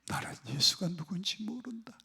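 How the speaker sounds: quiet, dismayed quote; hushed denial. Retold or imitated. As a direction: voice Peter's denial under your breath. Voicing Peter's denial in sermon.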